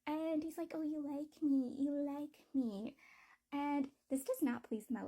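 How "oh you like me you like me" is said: higher-pitched, drawn-out cutesy teasing; cute, clingy teasing. Retold or imitated. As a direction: imitate someone being pouty and kissy, dragging it out. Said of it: "being pouty and being clingy and being all kissy".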